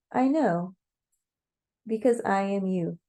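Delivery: usual speaking voice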